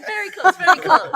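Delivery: amused encouragement; laughing reassurance. Real, not acted. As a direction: giggle while telling her it's close. Guest laughs at host's attempt.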